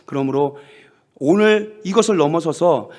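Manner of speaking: ordinary delivery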